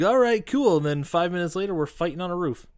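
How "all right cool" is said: casual henchman. Retold or imitated. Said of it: "and he's like".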